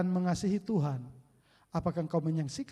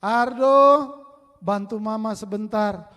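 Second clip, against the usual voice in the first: mother calling; 3 semitones higher, 10 dB louder, slower than usual. Retold or imitated. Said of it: "lalu mama teriak".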